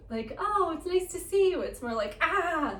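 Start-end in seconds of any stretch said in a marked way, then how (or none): 0.4-1.6 high, loud mock-friendly hello; exaggerated polite greeting in a raised voice; pleasant greeting. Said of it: "it's not like the bouncing is this". 2.2-2.8 high mock cry of alarm; short, raised "ah" like a small blow-up; acted little explosion. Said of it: "like little explosions".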